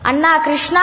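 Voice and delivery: loud high call into the forest; frightened boy calling Krishna in the forest; shouting for Krishna. Acted. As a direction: yell for your brother Krishna in fear. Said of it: "గట్టిగా అరిచాడు".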